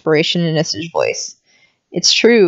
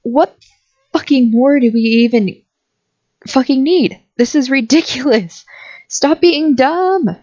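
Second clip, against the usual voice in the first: higher, heated and exasperated, slowing on the last words; angry rant; 6 semitones higher than usual. Acted. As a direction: lose patience and scold them. Angry argument over the proof.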